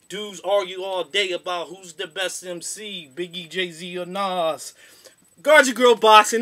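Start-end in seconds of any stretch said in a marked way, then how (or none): 5.4-6.4 shouted show name. Real, not acted. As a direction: belt out the show name, hype announcer.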